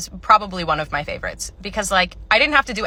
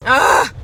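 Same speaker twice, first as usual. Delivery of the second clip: exasperated groan; 4 semitones higher, 9 dB louder than usual. Acted. Customer groans.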